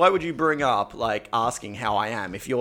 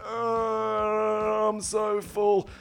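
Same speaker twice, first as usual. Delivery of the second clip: higher whiny moan, very slow; mock overstuffed moan; 7 semitones higher, 3 dB quieter, much slower than usual. S0 mimics his friend moaning at the bar, slow and whiny. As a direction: drag it out like he can't move. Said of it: "He sits there".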